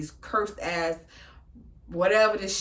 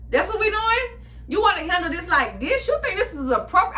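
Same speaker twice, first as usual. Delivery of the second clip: high, louder furious telling-off; angry confrontation; 7 semitones higher, 3 dB louder than usual. Retold or imitated. Vlogger replaying how she told off her stalker, high angry. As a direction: tear into him in a parking lot. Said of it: "I told him".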